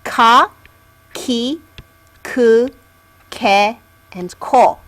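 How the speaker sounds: ka, ki, ku, ke, ko said one by one, unhurried; k row read out. Shown as a demonstration. Teacher modelling the k column for learners. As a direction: model each k syllable clearly. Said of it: "This is the K sound column".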